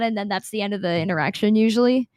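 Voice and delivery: ordinary talk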